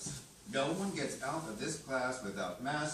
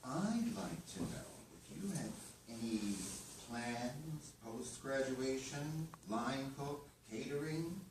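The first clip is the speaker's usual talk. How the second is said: softer, lower private question; quiet aside; 4 semitones lower, 9 dB quieter than usual. Acted. Chef drawing a graduate aside to ask his plans, quietly. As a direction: speak low, just between the two of you.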